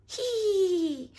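high, drawn-out squeal of glee; happy shout. A narrator's reading. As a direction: squeal loudly like a delighted little one. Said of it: "shout George happily".

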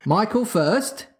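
high, slow insistence; dogged. A narrator's reading. Father insisting his son goes first, doggedly. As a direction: dig in stubbornly, drawn out. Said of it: "he said doggedly".

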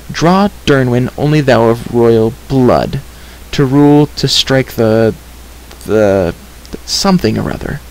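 put-on slow, halting read-out of an old inscription; halting deciphering. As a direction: piece the words out, pausing between phrases. Girl deciphers worn inscription aloud.

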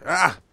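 sharp gasp of shock; a loud startled yelp at the gore; startled cry. Acted. Finding remains in the hall, a startled cry.

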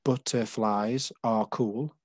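"Butterflies are cool" said slowly, beat by beat; slow counted reading of "Butterflies are cool"; syllables spelled out slowly. Shown as a demonstration. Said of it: "the first line there".

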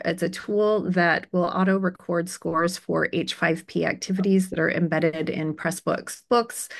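normal talking voice

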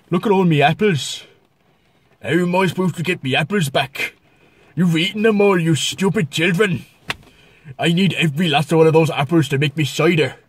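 indignant Cornish apple grower; Cornish accent. Shown as a demonstration. Cornish grower telling children off over apples, Cornish accent. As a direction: scold them in a put-on Cornish accent. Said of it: "bonus Cornish accent".

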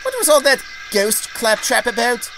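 high, loud, indignant question; huffy Daisy. A narrator's reading. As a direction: demand an explanation in a high, haughty huff. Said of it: "flounced Daisy".

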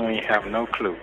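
usual speaking voice

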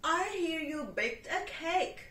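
a hint of a bleating goat. Goat asking the cat for cake. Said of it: "said the goat".

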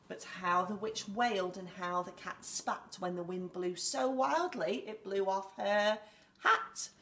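story reading. Acted. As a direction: read it with rising drama. Reads the story aloud.

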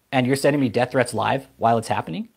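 ordinary talk, nothing marked

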